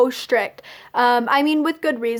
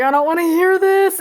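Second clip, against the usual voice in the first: higher, pleading mock whine; pained protest, pitched up; retold distress; 4 semitones higher, 4 dB louder than usual. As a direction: replay not being able to bear it. Retold distress at mom's crying.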